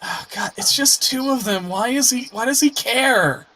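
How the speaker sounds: high-pitched, put-upon complaint; exasperated character. Acted. Character grumbling about two pledged kobolds, exasperated. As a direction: complain in disbelief, pitched well up. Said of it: "she would've been like".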